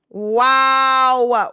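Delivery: loud, long drawn-out wow; awestruck wow. Real, not acted. Fan looking at actor photos, awestruck. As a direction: hold the vowel loud in admiration.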